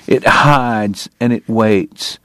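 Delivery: usual speaking voice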